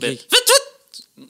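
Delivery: very high, slow wailing siren; fire siren imitation. Shown as a demonstration. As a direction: wail like a fire truck siren. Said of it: "une, une sirène de pompier".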